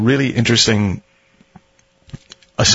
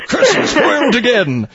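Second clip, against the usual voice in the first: higher, slow, hammed-up villain; sneering panto villain; 13 semitones higher, 3 dB louder, slower than usual. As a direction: snarl it like a foiled villain. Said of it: "the villain role".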